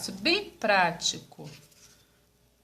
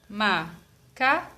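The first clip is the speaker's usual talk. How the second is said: "Macaco" drawn out slowly as ma, ca, co; syllable by syllable; 3 semitones lower, much slower than usual. Shown as a demonstration. Teacher splitting macaco for pupils, syllable by syllable. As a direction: separate "Macaco" into three slow beats. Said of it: "Aqui eu tenho três pedacinhos".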